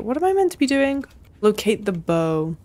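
ordinary talk, nothing marked

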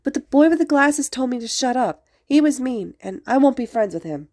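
high-pitched sulky complaint; dramatic sniffling. A narrator's reading. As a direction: play the wronged child. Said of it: "he sniffed dramatically again".

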